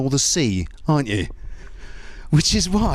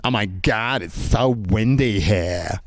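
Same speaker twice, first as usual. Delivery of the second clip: mock American, lower and drawn out at the end; American tourist accent; 5 semitones lower, slower than usual. American tourist complaining about Dutch weather, mock American accent. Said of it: "these American tourists talking".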